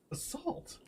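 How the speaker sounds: high, soft disbelieving word; incredulous. Real, not acted. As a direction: squeak it out in disbelief.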